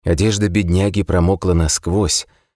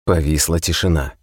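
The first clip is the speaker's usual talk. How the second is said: ordinary delivery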